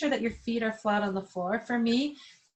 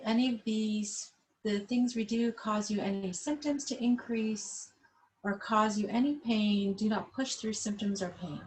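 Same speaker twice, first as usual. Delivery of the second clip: normal talking voice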